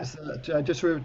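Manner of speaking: ordinary talk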